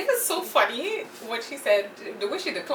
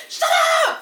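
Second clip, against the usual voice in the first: high piercing mock yell, much louder; mock screaming; 5 dB louder than usual. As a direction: scream it like a reality star on camera. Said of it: "I would do".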